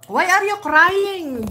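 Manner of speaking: loud, high and drawn out; loud concerned call. Real, not acted. Streamer asking a viewer why they cry, loud drawn-out call.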